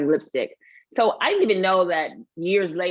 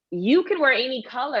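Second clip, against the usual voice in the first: higher-pitched, encouraging friend voice; lightly voiced pushy friend; insistent friend; 8 semitones higher than usual. Retold friend urging her.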